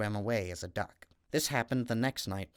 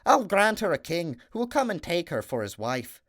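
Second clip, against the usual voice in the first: higher, louder grand promise; third little man; 7 semitones higher, 5 dB louder than usual. Acted. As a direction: bestow a king with a flourish. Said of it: "the third little man said".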